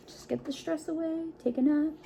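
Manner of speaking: soft, high, soothing; hushed and high, lulling the children. Acted. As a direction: ease the stress away gently. Soothing the kids about bedtime.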